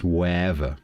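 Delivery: "wherever" as whatever with no T, vowels run together; almost a diphthong. Shown as a demonstration. Londoner showing where whatever is heading, almost a diphthong. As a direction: glide through "wherever" without any throat catch. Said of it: "It's almost a diphthong".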